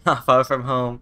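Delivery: loud laughing repeat. Pretend. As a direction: laugh it out loudly.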